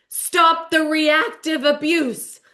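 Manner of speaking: slow, high, emphatic mock decree; grand proclamation. [name] proclaiming her friendship amendment, slow and emphatic. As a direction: draw out each word like a decree.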